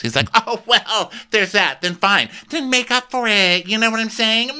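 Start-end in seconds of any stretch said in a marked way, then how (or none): 0.3-2.3 high, loud petulant reply; mock huffy. Acted guest's huffy reply. 2.5-3.7 very high, brisker insistence on "make up for it"; mock petulant demand. First take of acted demand. 3.7-4.6 very high, whiny prodding; mock insistent. Acted guest insisting.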